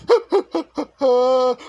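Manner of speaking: the villain giggling over his captive; creepy giggle. Acted. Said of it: "He giggled and giggled".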